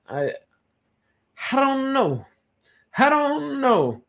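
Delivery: high, sing-out shrug, stretched on the second; playful high-pitched dithering; drawn-out uncertainty. Real, not acted. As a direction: stretch it out high, then let it fall.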